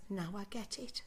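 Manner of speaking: soft hushed whisper of understanding; the brain quietly clicking, whispered; whispered realisation. Retold or imitated. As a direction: breathe it like a secret you've just grasped. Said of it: "your brain goes".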